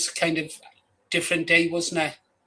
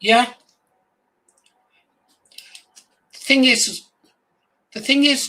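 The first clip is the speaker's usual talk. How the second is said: louder and higher, pressing the point; loud emphatic restart; 8 semitones higher, 8 dB louder than usual. Real, not acted. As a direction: jump in loud to make a point. Bursts in loudly, restarting.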